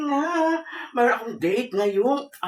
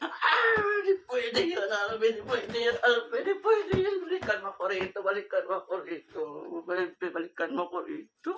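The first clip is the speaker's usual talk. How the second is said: acted sobbing plea, much softer; crying; 7 semitones higher, 7 dB quieter than usual. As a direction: wail high, then sink into soft weeping. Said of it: "ba't ka umiiyak".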